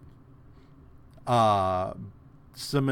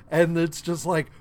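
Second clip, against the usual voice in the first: normal talking voice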